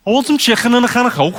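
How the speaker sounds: high, quick Scouse with throaty k sounds; Scouse accent. Shown as a demonstration. Comic using a phrase to get started, Scouse. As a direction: rasp the k's in broad Liverpool. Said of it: "the phrase I use to do the Scouse accent".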